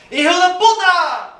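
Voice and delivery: high, loud cry of hijo de puta; yelled Spanish curse. Retold or imitated. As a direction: scream it diving into the snow. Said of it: "yell out".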